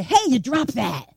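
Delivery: rough, accusing "Hey, you dropped that"; mock-confrontational delivery; harsh inflection. Shown as a demonstration. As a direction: say it harshly, as a demonstration. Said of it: "how voice inflection can matter".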